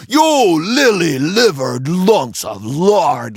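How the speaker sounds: loud, scornful pirate rant; lashing out. A narrator's reading. Dirty Joe lashing out at his cowardly crew. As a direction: tear into the cowards. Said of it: "lashed out Dirty Joe".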